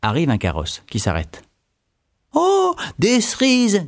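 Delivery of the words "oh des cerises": high, slow grand-lady voice, much higher; a noble lady drawing out her delight at cherries; the marquise. A narrator's reading. Marquise spotting cherries from her carriage, high and slow. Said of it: "dit la marquise".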